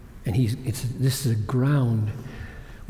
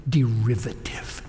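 whisper; 4 semitones lower, 4 dB quieter than usual. Real, not acted. Hushed closing word.

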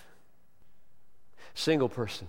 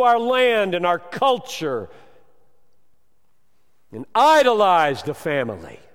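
loud, high preaching at full force; thundering sermon voice; fiery preaching; 7 semitones higher, 9 dB louder than usual. Acted. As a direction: preach it hard to the congregation.

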